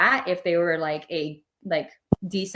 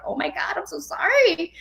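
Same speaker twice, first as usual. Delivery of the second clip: higher, mock heartfelt sorry; imitating sympathy; 11 semitones higher than usual. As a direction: pour out pity for the lonely soldier. Retold sympathy to ghost soldier.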